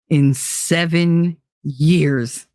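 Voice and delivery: SEVEN YEARS drawn right out; weighty, word-by-word 'seven years'; emphatic slow repetition. Real, not acted. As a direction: stretch 'seven years' very slowly so it sinks in. Repeats duration to let it sink in.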